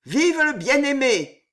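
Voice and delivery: high, loud ringing shout; ringing cry. A narrator's reading. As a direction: call it out, bright and ringing. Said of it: "la voix éclatante d'Henri d'Étiole".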